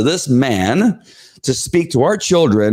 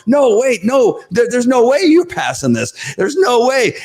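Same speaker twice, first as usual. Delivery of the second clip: crowd protest; 13 semitones higher than usual. Retold or imitated. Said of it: "they're going".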